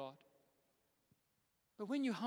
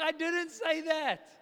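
flustered denial; 7 semitones higher, 6 dB louder, slower than usual. Retold or imitated. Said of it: "I was like".

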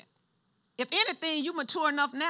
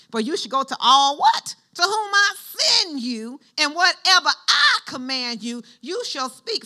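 higher, louder scripture read with a catch; fervent scripture preaching; 3 semitones higher, 7 dB louder than usual. Acted. Preacher proclaiming God's charge to Jeremiah, fervent. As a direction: preach God's command with fervour.

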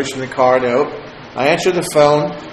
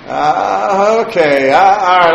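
normal talking voice

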